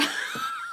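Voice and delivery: squeaky burst of laughter; a high giggle at herself; sudden high giggle. Real, not acted. She giggles at her own dating line.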